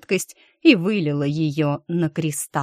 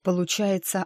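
usual speaking voice

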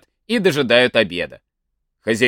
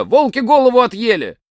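high, loud cry with голову punched; shouting alarm; 9 semitones higher, 3 dB louder than usual. Acted. Lodger shouts to the parents in high mock alarm. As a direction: yell it like a terrible disaster. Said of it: "закричит во всё горло".

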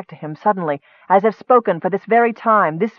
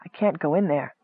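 hushed, fearful refusal; 2 semitones lower, 8 dB quieter than usual. A narrator's reading. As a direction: shrink back softly in dread. Terrified woman balks at dark forest.

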